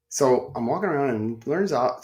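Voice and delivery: ordinary talk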